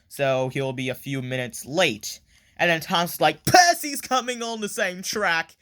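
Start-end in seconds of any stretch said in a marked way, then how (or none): 3.4-5.5 high-pitched warning cry; hammed-up panic at a coming crash; mock alarm. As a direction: call out an urgent warning, pitched up.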